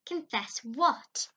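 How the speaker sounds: put-on stern old woman; stern question. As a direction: snap it back. Miss Barry's stern question.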